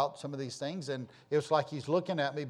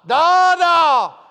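put-on high, loud child's call across the yard; high shouted call of a little boy; child yelling; 16 dB louder, much slower than usual. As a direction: yell it like a toddler calling from the fence. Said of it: "and he'd stand there and he'd yell".

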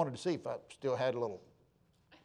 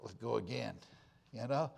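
quiet teasing challenge; 6 semitones lower, 12 dB quieter than usual. Retold or imitated. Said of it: "I come in and said".

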